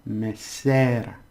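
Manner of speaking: "Mesera" slow, with a drawn-out middle syllable; stress on SE. Shown as a demonstration. Teacher shows what is stressed, a long SE in "Mesera". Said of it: "So, this is stressed".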